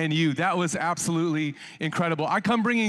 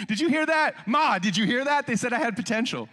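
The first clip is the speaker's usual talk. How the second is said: high-pitched, acted youthful excitement; playful excited boast; 5 semitones higher than usual. Young self bragging to Ma about potential, playful excitement. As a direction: call out proudly to Mom, hammed-up.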